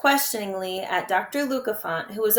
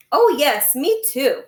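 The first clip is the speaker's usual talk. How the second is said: quick, bright eager agreement; eager little brother chiming in; 5 semitones higher, 7 dB louder, slower than usual. Acted. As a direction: jump in eagerly to back her up. Klaus eagerly backs Violet.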